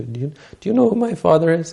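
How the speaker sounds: acted-out affronted challenge; indignant retort. Acted indignant retort to father-in-law.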